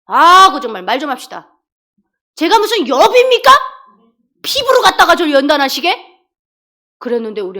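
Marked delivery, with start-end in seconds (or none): 0.0-6.1 loud, high, exasperated mock protest; acted-out exasperated prayer, raised voice; indignant complaint to God. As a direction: argue with God like an aggrieved child of his. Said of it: "하나님께 기도를 드렸어요".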